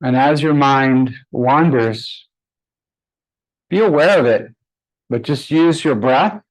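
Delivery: unhurried, even meditation voice with long pauses; guided meditation. Acted. Yoga teacher guiding her class to close their eyes, calm meditation voice. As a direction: ease the class inward, one phrase at a time.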